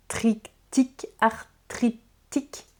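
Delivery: "arthritique" broken into slow, separate syllables; sounding out. Real, not acted. Vlogger tripping on a word in the blurb, "arthritique" sounded out syllable by syllable. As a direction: pick through "arthritique" bit by bit, softly, with gaps.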